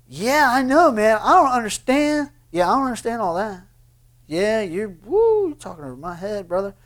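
imitating a smug brush-off, high and loud, dropping off at the end; mocking a know-it-all. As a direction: play the guy who waves it away, then fades. Mimics a dismissive listener.

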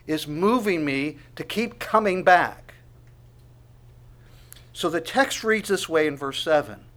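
ordinary talk

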